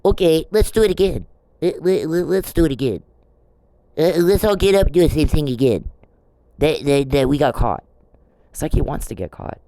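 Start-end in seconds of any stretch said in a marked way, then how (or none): 0.0-7.8 higher, louder mocking voice of a clueless offender; mocking Perry. Speaker mocking Perry for getting caught, in a higher, louder voice. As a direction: ham him up as a dim repeat offender. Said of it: "And here's Perry".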